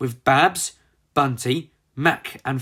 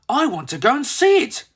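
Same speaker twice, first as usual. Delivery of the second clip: eager; 10 semitones higher, 3 dB louder than usual. Acted. Eager young Molly.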